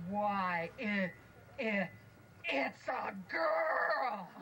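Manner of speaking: slow, drawn-out amazed cry; amazed dwarf. Acted. Dwarf discovering it's a girl, slow and amazed. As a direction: draw it out in wonder.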